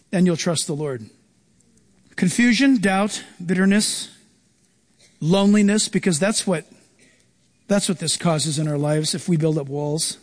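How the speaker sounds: normal talking voice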